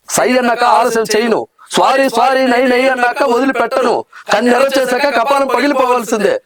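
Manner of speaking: angry hero dialogue. Acted. Hero boasting of his Palnadu lineage and daring his enemies, acted fury. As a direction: challenge the whole gang, fierce and proud. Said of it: "ఇంకో dialogue".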